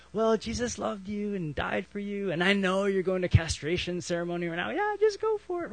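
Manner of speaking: high, breezy mock-reassurance; mock indulgent approval. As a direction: wave them on cheerfully, pitch climbing at the end. Acted breezy permission to castrate.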